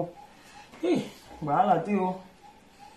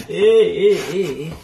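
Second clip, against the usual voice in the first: mock wail; 9 dB louder than usual. Imitates crying wail again.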